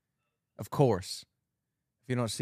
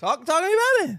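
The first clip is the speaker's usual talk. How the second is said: excited; 5 dB louder than usual. Real, not acted. Pushing back, defending his word.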